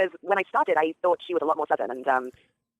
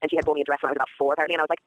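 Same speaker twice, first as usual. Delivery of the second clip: normal talking voice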